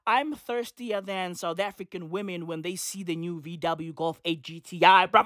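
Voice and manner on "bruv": usual speaking voice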